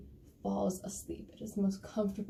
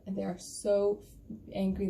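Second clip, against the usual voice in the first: ordinary delivery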